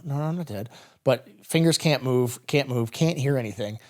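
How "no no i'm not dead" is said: desperate denial. Retold or imitated. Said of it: "I remember thinking to myself".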